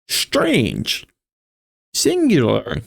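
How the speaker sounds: high quoted words. A narrator's reading. Quoted crowd words voiced.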